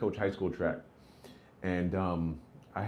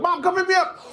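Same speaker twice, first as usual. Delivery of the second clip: put-on high, pleading kid's voice; squeaky kid shouting down the phone; kid calling mom; 4 dB louder than usual. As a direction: beg mom for a ride like a child. Kid calling collect to his mom.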